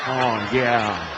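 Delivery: low, lightly voiced lustful moaning; sarcastic low bedroom murmur; mock aroused husband. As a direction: groan it like a husband faking desire. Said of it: "their husbands are going".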